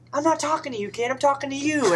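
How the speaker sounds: high mock announcer correcting a kid; lightly voiced old announcer setting a kid straight; Harry Carey voice. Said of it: "I finally found a good voice".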